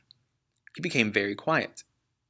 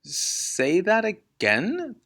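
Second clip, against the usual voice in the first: slow, high disbelieving question; stretched-out amazed question; incredulous; 8 semitones higher, much slower than usual. Acted. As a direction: draw it out in disbelief. Father stunned by the price.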